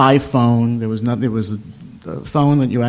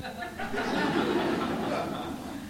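breathless laugh; 12 dB quieter than usual. Real, not acted. Teller laughs at the punchline.

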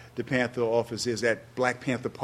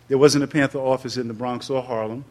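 ordinary delivery

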